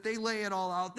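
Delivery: usual speaking voice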